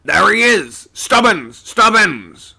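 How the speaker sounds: loud, high-pitched call; urgent shout. A narrator's reading. Skipper spots Stubbins aloft and calls to him, shouting. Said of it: "he shouted out".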